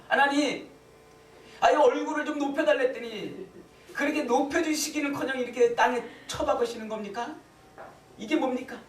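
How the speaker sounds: high-pitched, exasperated protest to God; grumbling complaint. Retold or imitated. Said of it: "원망하고 툴툴거리고 있는데". (하나님께 툴툴거리며 높은 목소리로 원망하듯)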